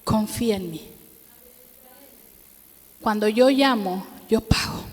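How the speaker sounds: a hint of a gentle divine whisper; voice of God. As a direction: speak it like a calm inner voice. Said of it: "el Señor solamente me dijo".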